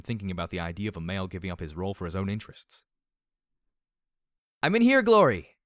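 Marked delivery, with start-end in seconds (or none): 4.6-5.6 high, full-volume shout to a friend; yelling. A narrator's reading. Heroine answers Glory calling from the hallway, yelling. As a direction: call out loudly from another room. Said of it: "I yelled as I rose quickly".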